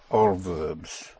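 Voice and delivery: usual speaking voice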